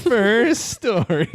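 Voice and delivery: slow, high sing-song announcement; drawn-out announcer. Acted. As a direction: stretch it out like a show host. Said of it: "I don't know why I did that".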